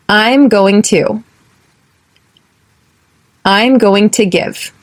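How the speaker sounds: slow, careful repeat-after-me phrasing; slow drill. Shown as a demonstration. Teacher slowly modelling a phrase for learners to repeat. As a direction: lay each word out slowly for learners to echo. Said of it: "Repeat after me".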